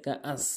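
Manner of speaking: soft কাআস with plain glottal catch; hamza for ain. Shown as a demonstration. Teacher repeating what many say, plain কাআস. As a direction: drop কাআস softly, without ain. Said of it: "অনেকে কাআস বলে".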